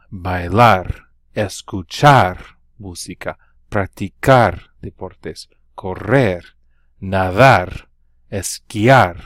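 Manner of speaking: Bailar, escuchar, correr, nadar, esquiar each punched loud on the final syllable; stress on the last syllable. Shown as a demonstration. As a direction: hit the last syllable of every verb. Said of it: "Notice that I stress that last syllable on these verbs".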